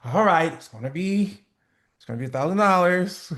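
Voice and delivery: hesitant, hedging price; unsure price pitch. Shown as a demonstration. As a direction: say the price like you doubt it. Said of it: "you say that shit like this".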